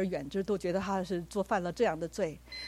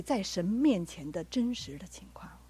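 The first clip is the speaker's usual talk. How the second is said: whisper; 5 dB quieter, slower than usual. Real, not acted. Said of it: "sermon point dropped to whisper".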